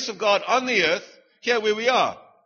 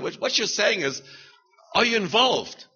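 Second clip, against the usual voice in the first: ordinary delivery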